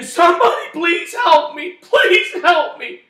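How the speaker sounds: high, loud desperate scream, slowing on the last words; screaming for help. Retold or imitated. Man hanging from live wire screams for help, loud scream. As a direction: scream for anyone to save you. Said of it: "I just started screaming".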